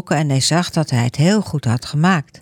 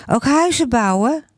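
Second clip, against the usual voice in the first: put-on curious child; 8 semitones higher than usual. Child asks teacher.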